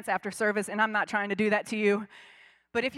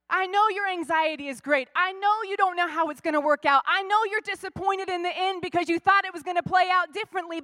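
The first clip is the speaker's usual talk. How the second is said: higher and louder, pleading as Jesus to the disciples; full-force preaching; 5 semitones higher, 4 dB louder than usual. Acted. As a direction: press the promise home, rising.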